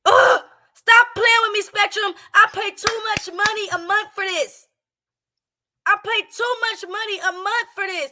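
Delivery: loud, high frustrated yelling at the internet. Real, not acted. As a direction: yell it out, fed up.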